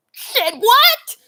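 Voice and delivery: high, startled yelp; mock shock. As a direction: squeak it out in fake alarm. Reacting to tornado news.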